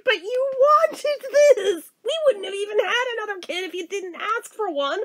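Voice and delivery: high, incredulous mock-mother voice through laughter; laughing mom. Mom reminding sobbing child who asked for this, imitated laughing protest. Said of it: "she's laughing".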